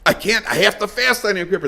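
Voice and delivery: high-pitched acted objection; upset protest. Man insisting he must fast on Yom Kippur, upset. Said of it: "Gets all upset".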